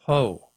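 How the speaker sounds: "Ho" said with a long O; long O syllable. Shown as a demonstration. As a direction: say "Ho" as one open O syllable. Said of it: "making the syllable".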